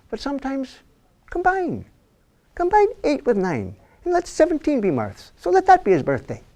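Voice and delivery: high, preachy priest pleading; priest's sermon voice. Acted. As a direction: lift the voice like a village priest coaxing.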